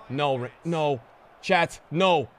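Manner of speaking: ordinary talk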